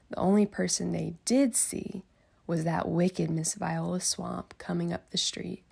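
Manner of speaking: ordinary delivery, nothing marked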